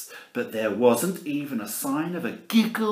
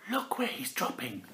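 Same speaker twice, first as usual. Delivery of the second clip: plain reading voice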